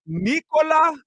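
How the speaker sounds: high, loud name call. Real, not acted. Calling out a viewer's name.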